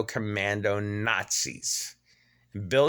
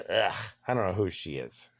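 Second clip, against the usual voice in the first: ordinary talk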